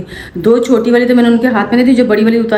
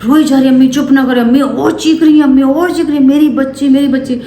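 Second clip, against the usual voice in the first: retold wailing mother; 5 semitones higher than usual. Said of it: "रोना शुरू किया".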